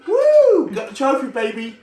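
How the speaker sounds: high, loud victory cheer; whooping with joy over the trophy; excited whoop. Real, not acted. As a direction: shout it out in real triumph. Celebrating winning the trophy.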